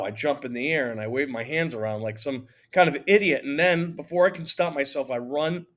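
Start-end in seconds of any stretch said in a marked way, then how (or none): none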